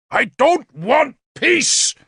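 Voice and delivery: high, slow shout, word by word; furious shout. A narrator's reading. King rejecting Galahad's plea for peace, furious shout. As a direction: hammer out each word in rage. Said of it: "Gorfethid shouted at Galahad".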